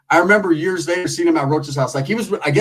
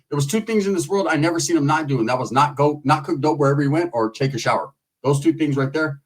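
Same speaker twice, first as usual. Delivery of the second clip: ordinary delivery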